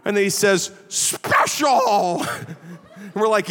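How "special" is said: high, drawn-out mock squeak; high squeaky professor. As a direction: sing out the word like an odd little professor. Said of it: "he had this high squeaky voice".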